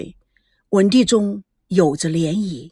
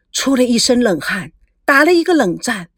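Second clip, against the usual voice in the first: ordinary delivery, nothing marked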